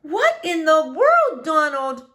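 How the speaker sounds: a wife thrown by the roaring machine; startled wife. Acted. Mrs Tickham startled by vacuum.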